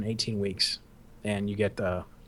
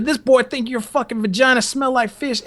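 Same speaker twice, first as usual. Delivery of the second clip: high-pitched mock indignation, running on; outraged instructor; 9 semitones higher than usual. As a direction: imitate a superior denouncing a recruit to onlookers. Instructor exposing cadet, retold.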